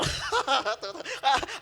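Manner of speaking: high burst of laughter; mischievous laugh. Acted. Nuaiman admits selling his friend, mischievous laughing. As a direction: laugh at your own prank.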